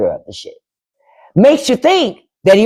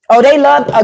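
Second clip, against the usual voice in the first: normal talking voice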